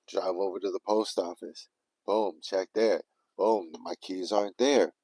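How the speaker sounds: usual speaking voice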